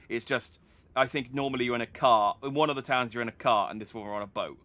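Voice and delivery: usual speaking voice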